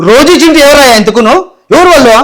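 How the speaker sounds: loud, indignant demand who they are; baffled shout. Acted. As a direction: shout it out, outraged and confused. Puzzled brother shouts at neighbour.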